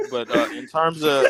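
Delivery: usual speaking voice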